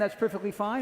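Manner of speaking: ordinary talk, nothing marked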